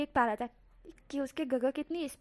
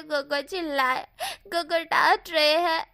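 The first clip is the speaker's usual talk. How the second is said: slow, loud sobbing complaint; sobbing through the words; crying; 4 semitones higher, 7 dB louder, slower than usual. Acted. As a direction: wail it out like a scolded child. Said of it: "इबो रोते हुए कहता है".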